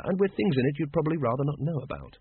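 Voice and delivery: ordinary delivery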